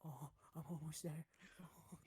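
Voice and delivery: breathy mock-sexy whisper; hammed-up bedroom whisper; mock sultry whisper. Mock sex talk, whisper.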